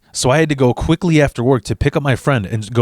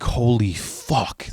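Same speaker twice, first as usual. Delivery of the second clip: stunned; 6 dB quieter, much slower than usual. Retold or imitated. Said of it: "I'm like".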